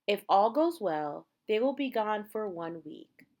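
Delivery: usual speaking voice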